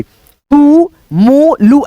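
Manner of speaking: "तू, मू, लू" each with a lengthened ऊ; drawn-out long vowels. Shown as a demonstration. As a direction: sound out "तू, मू, लू" one by one. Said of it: "ऐसे करके हम पढ़ते हैं".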